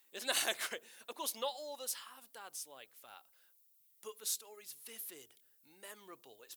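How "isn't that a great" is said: pushing for a response, louder and higher; prodding the audience to answer; louder repeat. Acted. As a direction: ask it again with more insistence. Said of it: "it's a good story".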